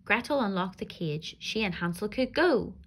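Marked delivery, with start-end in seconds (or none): none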